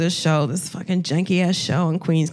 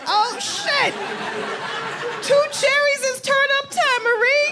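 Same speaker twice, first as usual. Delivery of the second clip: excited. Retold or imitated. Reacting to mom's drinks.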